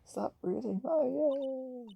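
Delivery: very high, slow, drawn-out menace; crazed threat. Acted. Maddened attacker repeating his threat. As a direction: stretch the threat out madly.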